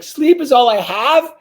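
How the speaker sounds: high, loud, slowed disbelief, mocking my own words; incredulous shriek. Repeating his line back to her in an argument, shrill disbelief. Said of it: "And I was like".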